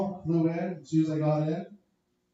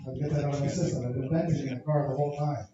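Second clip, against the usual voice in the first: ordinary delivery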